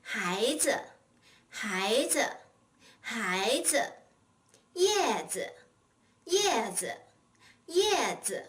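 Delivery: spaced teacher's drill of 孩子, 叶子, 帽子, letting 子 drop away lightly; neutral-tone 子 drill. Shown as a demonstration. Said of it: "learn how to pronounce it in a neutral tone".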